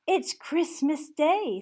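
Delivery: high, slow and amazed; awed delight. Acted. Scrooge realizing it's Christmas, slow and amazed. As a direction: marvel at it out loud. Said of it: "said Scrooge to himself".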